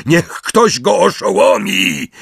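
guard shouting. A narrator's reading. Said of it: "trzymający go śmierciożerca krzyknął".

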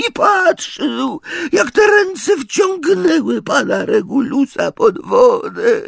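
squeaky and grieving; tearful house-elf. A narrator's reading. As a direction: elf recalling his master's death. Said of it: "Zaniósł się takim szlochem".

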